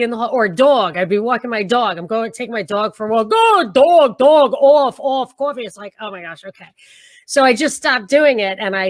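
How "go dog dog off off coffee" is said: loud mimicry of New York vowels; mocking New York accent. Texans making fun of her coffee, mocking New York accent. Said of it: "they would make fun of me".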